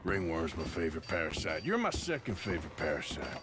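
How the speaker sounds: low, dry teasing put-down; matter-of-fact, low-pitched ribbing; deadpan correction. Acted. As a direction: drop the voice and correct yourself deadpan.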